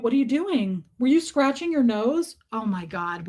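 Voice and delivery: ordinary talk, nothing marked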